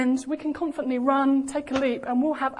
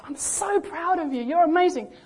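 higher, emphatic burst of fatherly pride; proud shout; 5 semitones higher than usual. Acted. God the Father declaring pride in his child, a raised proud cry. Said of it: "what he shouts out the loudest".

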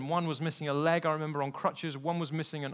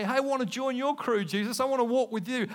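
lightly acted keenness, pitched up; eager young follower; 8 semitones higher, 4 dB louder than usual. Rich young man asking to join Jesus, lightly played eagerness. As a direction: pipe up like a keen would-be recruit.

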